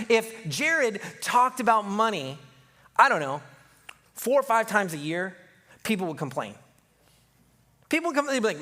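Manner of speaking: normal talking voice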